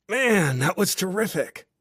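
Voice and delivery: exhilarated exclamation; elated. A narrator's reading. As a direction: gush like a thrilled tourist. Cole elated after his jumps.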